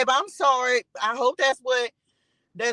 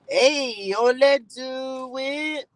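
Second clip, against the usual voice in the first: drawn-out hype; much slower than usual. Real, not acted. Hyping herself up on live.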